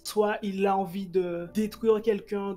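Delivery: usual speaking voice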